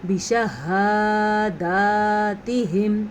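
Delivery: بشہاداتہم with ha and dal both stretched, very slow; both vowels long. Shown as a demonstration. Teacher showing how to lengthen both vowels. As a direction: draw out ha and dal equally. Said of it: "اور وہ کیسے کرنا ہے".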